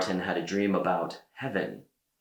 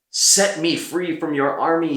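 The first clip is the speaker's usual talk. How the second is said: begging; 7 semitones higher, 5 dB louder than usual. A narrator's reading. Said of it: "he begged".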